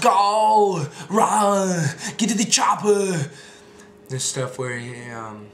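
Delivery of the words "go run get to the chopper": higher, much louder, slow scream; Arnold screaming. Retold or imitated. Arnold ordering escape, loud slow scream. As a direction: bellow like Arnold at the chopper. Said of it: "just screaming".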